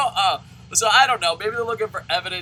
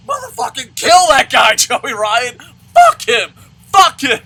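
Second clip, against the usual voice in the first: much louder, higher bloodthirsty yell; Jack Bauer fury; 10 semitones higher, 16 dB louder than usual. Retold or imitated. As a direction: scream for the kill like an action hero. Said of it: "all Jack Bauer".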